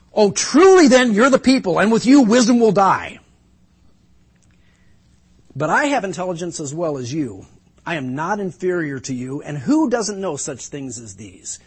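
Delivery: loud, high mocking praise; sarcastic. Job mocking his friends' wisdom, loud sarcasm. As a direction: drip scorn on their wisdom. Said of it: "Job starts to get sarcastic".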